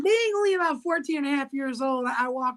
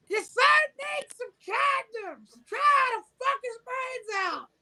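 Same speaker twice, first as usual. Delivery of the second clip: high slow shouted announcement; hammed-up shout; 14 semitones higher than usual. Girlfriend shouting her demand down to his mom. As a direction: call it down the stairs, brazen.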